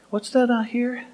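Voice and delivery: high, soft put-on question; prophet feigning surprise. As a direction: softly pretend to overhear something. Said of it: "God sends the prophet to say".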